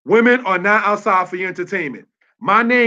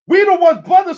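loud, high, driving the point home; preacher's raised cry; emphatic shout; 8 semitones higher, 6 dB louder than usual. Real, not acted. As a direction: call it out to the crowd.

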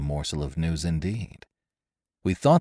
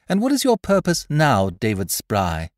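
high, loud question dropping on the name; demanding; 6 semitones higher, 6 dB louder than usual. A narrator's reading. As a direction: demand an answer in a raised voice. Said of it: "she demanded".

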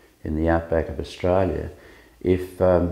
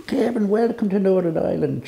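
high-pitched Northern Irish soldier impression; Irish accent; 13 semitones higher than usual. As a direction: greet him in a much higher Irish voice. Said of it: "you do a good Irish accent".

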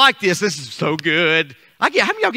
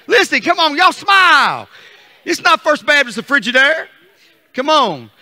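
pep-talk push; 8 semitones higher, 6 dB louder than usual. Real, not acted. Teasing the quiet congregation.

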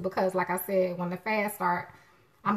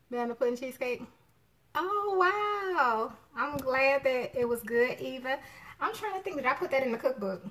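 usual speaking voice

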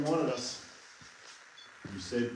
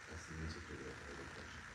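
whispering; 7 semitones lower, 14 dB quieter than usual. Real, not acted. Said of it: "that was whispered".

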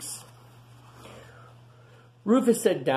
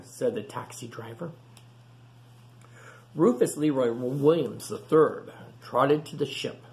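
ordinary delivery, nothing marked

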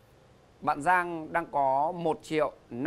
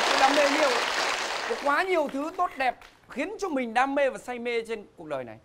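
excited; 10 semitones higher than usual. Real, not acted. Host proclaims many passions.

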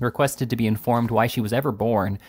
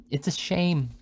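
pitched-up reproach; reproachful; 6 semitones higher, slower than usual. A narrator's reading. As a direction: scold on your mistress's behalf, mildly. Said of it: "said the bodyguard reproachfully".

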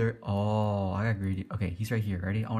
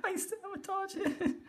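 high, slow squeal through laughter; helpless laughter; 5 dB quieter, slower than usual. Real, not acted. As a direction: barely get the words out laughing. Laughing at own prank.

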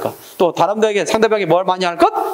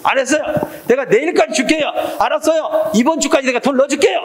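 high-pitched, acted insistent promise; hammed-up assurances, pitched well up; promising; 8 semitones higher than usual. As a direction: pretend to swear it to win them over. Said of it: "약속을 통해서 상대방을".

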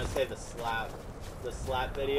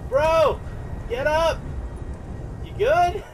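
calling out; 11 semitones higher, 4 dB louder than usual. Real, not acted. Calling to fallen friend.

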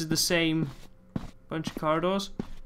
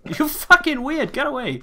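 startled yell; 11 semitones higher, 5 dB louder than usual. Real, not acted. Recoiling from an NPC.

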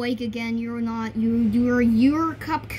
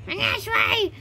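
squeaky voice; 7 semitones higher than usual. Acted. High put-on voice lecturing kids.

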